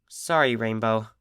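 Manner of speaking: soft, low apology murmur. A narrator's reading. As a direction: mumble it into the ground. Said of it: "Scootaloo murmured".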